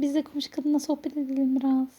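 usual speaking voice

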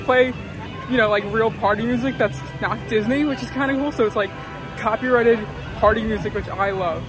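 ordinary talk

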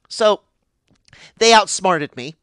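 usual speaking voice

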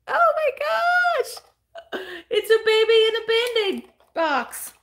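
real high-pitched cooing delight; delighted squeal. Real, not acted. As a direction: squeal with delight, stretching the words. Delighted at finding baby doll in tin.

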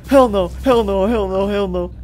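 panic, then run out of breath; repeated frightened hell no, dying away; panicked refusal. Real, not acted. Fleeing mobs, repeated.

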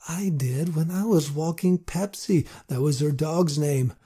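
ordinary delivery, nothing marked

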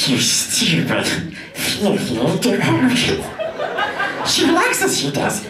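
very high, hissing split-personality creature; Gollum. Retold or imitated. Gollum arguing with himself about the girl, high and hissing. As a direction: snarl and wheedle like Gollum. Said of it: "Gollum from Lord of the Rings".

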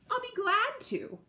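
high, eager little cat; a cheerful, obliging cat. A narrator's reading. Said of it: "said the cat".

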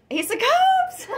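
very high, loud squeal of delight; excited shriek. Real, not acted. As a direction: shriek it like you just won. Thrilled at the card drawn.

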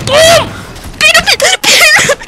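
very high, loud squealed repetition; frantic sputtering shout. Real, not acted. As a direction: squeal in helpless outrage. Sputters as hated weapon fails.

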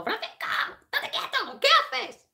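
very high, squealed impression of a panicked woman; mock screaming. Sisi screaming at Francisco over the sword, mock high-pitched shriek. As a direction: imitate her shrieking at him to stop. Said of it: "y Sisi por ahí gritando".

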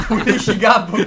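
laughing, drawn-out repeat; amused echo. Real, not acted. Friend echoes the absurd mission text, laughing. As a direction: read it back slowly through real laughter.

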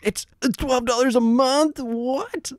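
very high, incredulous squawk; sticker shock. Real, not acted. Streamer seeing the monthly price, high shocked disbelief. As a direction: balk at the price in a high-pitched yelp. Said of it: "the sticker shock here".

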